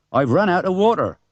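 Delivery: a hint of a gruff country farmer; dismissive farmer, lightly played; lightly voiced farmer. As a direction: farmer brushing it off. Farmer Hearn voiced in story.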